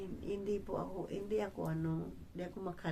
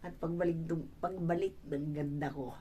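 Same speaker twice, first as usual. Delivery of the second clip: ordinary talk, nothing marked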